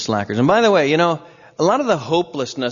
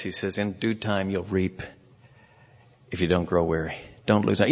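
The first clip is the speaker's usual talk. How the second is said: gentle encouragement; 6 semitones lower, 4 dB quieter than usual. Real, not acted. Gentle encouragement to weary believers.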